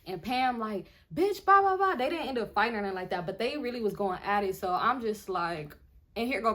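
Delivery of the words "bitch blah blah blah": high, loud blah-blah rant; mock yelling. Pam going at it with her rival, loud mock yelling. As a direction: imitate someone going off in a fight.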